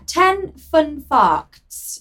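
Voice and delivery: hammed-up posh announcement; posh accent. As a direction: draw each word out in put-on posh. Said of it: "to do a posh accent".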